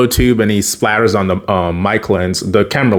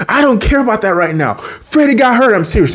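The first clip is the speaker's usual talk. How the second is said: higher, pressing, exasperated plea; retold urgent insistence; 10 semitones higher, faster than usual. Sam brushes off the pizza, urgent. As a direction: insist in a raised voice.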